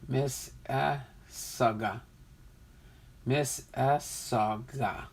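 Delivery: "Miss Issaga" slowly, in separated syllables; slow, careful repeats of "Miss Issaga"; sounding it out. Shown as a demonstration. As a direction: practise "Miss ... Iss ... aga", pausing between parts. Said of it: "never thought of it that way".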